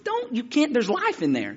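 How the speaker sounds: high-pitched urgent granny protest; pleading grandmother. Retold or imitated. Grandmother protecting cocoons from boy, pleading reproach. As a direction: imitate granny begging him to stop.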